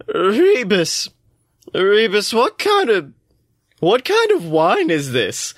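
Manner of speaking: high, slurring tipsy god; getting drunk, losing grip. Acted. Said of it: "slightly swaying back and forth".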